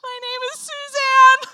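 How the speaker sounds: high, loud wailing retort; hammed-up crying shout; mock tearful yell. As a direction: yell it in exasperation, nearly crying. Acted tearful retort to the cheerleader.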